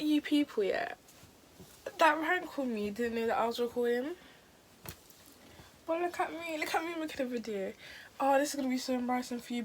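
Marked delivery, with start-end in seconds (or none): 5.9-9.6 high mocking taunt. Real, not acted. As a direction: gloat at the caller. Taunting the prank caller.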